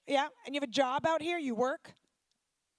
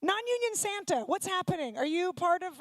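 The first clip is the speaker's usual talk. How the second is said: ordinary talk, nothing marked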